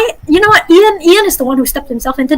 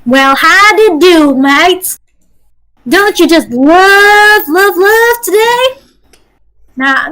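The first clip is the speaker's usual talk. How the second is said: put-on slow, drawn-out Southern belle accent; sweet Southern belle looking for her soulmate, slow; a cowgirlish Southern accent, stretched slowly; much slower than usual. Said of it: "So how Eclipse might actually talk is that she".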